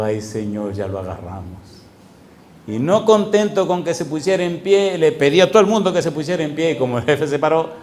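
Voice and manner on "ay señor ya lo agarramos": lower, softer, slower whisper of relief; hushed awe. Retold or imitated. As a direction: breathe it out in quiet awe. (susurro conmovido, más lento y bajo)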